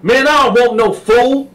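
high loud command; Sweet Lo demanding. Retold or imitated. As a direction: order him down. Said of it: "Sweet Lo was like".

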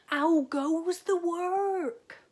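high, loud, slow bellow from bed; lazy farmer calling. A narrator's reading. Lazy farmer calling from bed to the duck, high loud bellow. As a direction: boom it out like a lazy boss.

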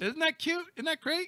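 high squeal of pretend delight; mock gushing. Host teasing a kitty lover, gushing. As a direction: gush sky-high over something cute.

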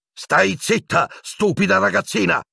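high-pitched furious outburst; losing control. Acted. Teacher silencing a girl, suddenly losing control. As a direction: yell as control suddenly goes. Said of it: "urlò Piton, perdendo il controllo all'improvviso".

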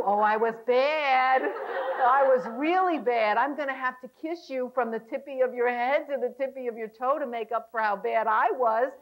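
slow, loud, drawn-out mock confession; baby talk. Wife coaxing her husband, playful baby talk. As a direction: ham up the naughty girl, stretching the words. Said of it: "knows how to talk baby talk".

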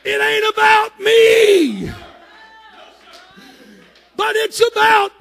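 loud, high-pitched shouted preaching; preaching at full force. Acted. Preacher shouting to his congregation, full-force preaching. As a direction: whoop it out to the whole congregation.

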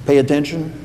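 normal talking voice

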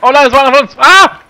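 very high, loud dismay; shocked outcry. Real, not acted. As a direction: shriek at a team kill. Shot a teammate, cries out.

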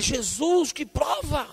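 high cry of exasperation; exasperated cry. Retold or imitated. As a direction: groan out to heaven at the ordeal. Exhausted complaint to Jesus.

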